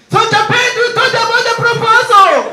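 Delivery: high, loud, laughing boss impression; hammed-up excited boss; mock boss voice. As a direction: squeal it like a giddy boss. Boss voice in retold meeting.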